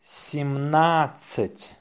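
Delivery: slow, drawn-out dictation. Shown as a demonstration. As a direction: say it slowly for learners. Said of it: "say it slowly".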